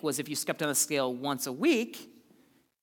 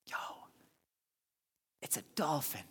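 whisper; 12 dB quieter than usual. Retold or imitated. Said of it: "this person on the beach says".

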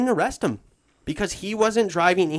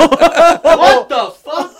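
high, slow, drawn-out What the fuck; shocked yell; 13 semitones higher, 16 dB louder, much slower than usual. Retold or imitated. Seeing his wrecked truck, a loud high shocked yell. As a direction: let the disbelief burst out loud and high.

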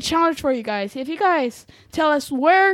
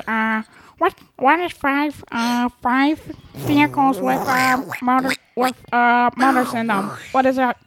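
a light, halting frog puppet voice; a kid's quick Kermit impression. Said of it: "I can do my Kermit the Frog impression".

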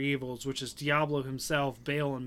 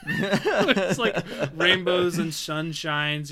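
laughing; 4 semitones higher, 6 dB louder, slower than usual. Retold or imitated. Mocking the happy cow level.

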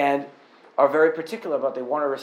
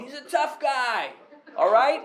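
higher, slow Boston tough-guy voice; Boston accent; 8 semitones higher, slower than usual. Retold or imitated. Pastor shows how Bostonians say it, Boston accent. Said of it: "that's how we would say it in Boston".

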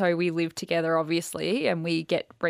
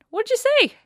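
alarmed question; 9 dB louder, much faster than usual. Retold or imitated. Her reply on the phone.

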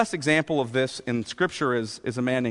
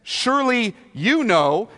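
raised-pitch, sarcastic cross-examination; sarcastic challenge; 5 semitones higher, 4 dB louder than usual. Acted. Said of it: "You hear the sarcasm".

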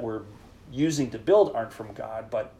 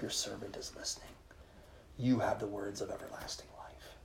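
soft whisper, barely voiced; whispered prayer; 11 dB quieter than usual. Shown as a demonstration. Priest showing a morning prayer to God, whispered. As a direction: breathe the prayer as you wake.

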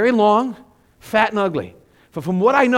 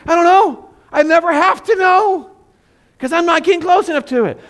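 high, loud protest; frantic, hammed-up refusal to go near; panicked retort; 12 semitones higher, 8 dB louder than usual. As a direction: yell back in mock panic. Acted frantic retort to kids.

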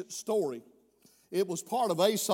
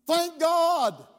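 louder, higher ringing "Thank God"; loud exclamation; 9 semitones higher, 8 dB louder than usual. Real, not acted. Preacher answers loudly.

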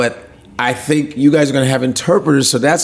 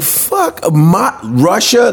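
high, squeaky mock disbelief; shocked disbelief; 7 semitones higher, much slower than usual. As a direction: imitate friends hearing the news in shock. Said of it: "They were like".

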